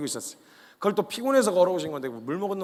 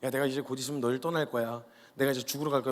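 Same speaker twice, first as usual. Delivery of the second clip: lower, subdued acted weakness; weak, resigned; 4 semitones lower, 4 dB quieter than usual. Jesus telling Peter he will leave and die, mildly weak. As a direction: say it low and feebly. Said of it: "되게 나약한 소리를 하시는 거예요".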